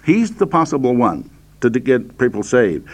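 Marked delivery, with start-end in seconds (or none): none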